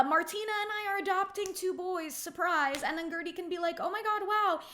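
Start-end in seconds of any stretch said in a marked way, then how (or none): 0.0-2.8 higher-pitched pretend surprise reveal; mock excited announcement. Imagined happy announcement by text. 3.8-4.6 high, louder pretend amazement; mock amazed reaction. Imagined reaction to surprise news.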